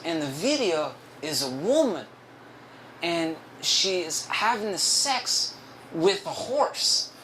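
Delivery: halting, swooping coworker impression; slow singsong imitation of an oversharing coworker; Ricardo. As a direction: drag it out phrase by phrase in his put-on voice. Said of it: "I'm gonna do his voice".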